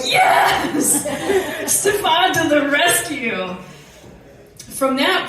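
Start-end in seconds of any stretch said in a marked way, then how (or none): 0.0-3.5 breathy laughing yes; a quick laughing cheer of relief; mock cheer of relief. Rider gets her bike back, laughing cheer.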